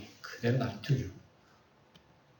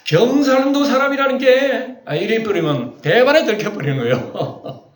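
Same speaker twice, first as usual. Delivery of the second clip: amused exclamation; 9 semitones higher, 4 dB louder than usual. Real, not acted. Jokes about being caught by accent.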